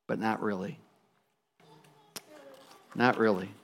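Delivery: low, hushed storyteller's aside; somber aside. A narrator's reading. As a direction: quietly let the sadness land, with pauses. Somber aside undercutting the happy line.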